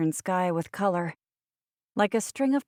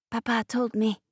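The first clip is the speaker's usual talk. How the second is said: put-on higher-pitched child's voice; little boy; 5 semitones higher than usual. A narrator's reading. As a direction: explain simply as a small boy, pitched up.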